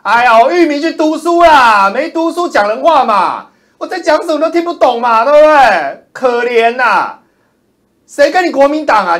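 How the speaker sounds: loud, high shouting tirade; furious rant. Real, not acted. A streamer shouts down hostile chat commenters, furious yelling. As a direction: yell down at the hecklers.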